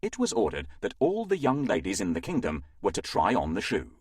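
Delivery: usual speaking voice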